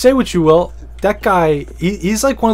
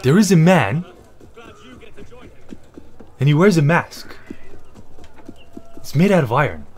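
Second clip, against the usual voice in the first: lightly voiced fairy-tale narration with long dramatic pauses; mock storyteller; 4 dB quieter than usual. Ranting about the actor, a lightly mock storybook narration. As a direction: tell it like a bedtime story, pausing after each bit.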